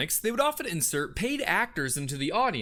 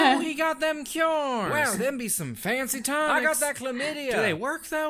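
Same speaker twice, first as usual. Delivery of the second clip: yokel crowd member; 5 semitones higher than usual. Acted. Crowd voices at the show.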